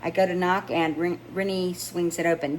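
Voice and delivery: plain reading voice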